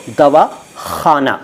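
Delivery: दवाखाना drawn out slowly; slow careful word. Shown as a demonstration. Teacher repeating दवाखाना slowly for viewers. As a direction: stretch दवाखाना slowly for learners. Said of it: "दवाखाना नहीं".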